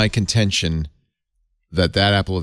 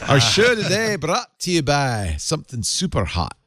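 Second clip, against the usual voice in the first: higher-pitched mock Scots accent; 8 semitones higher than usual. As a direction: keep up the playful Scottish put-on. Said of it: "start talking with a Scots accent".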